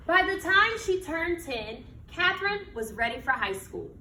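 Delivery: slow, expressive picture-book reading; reading to children. Acted. Teacher reading a picture book to her class, storytelling voice. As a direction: read it like storytime on the rug.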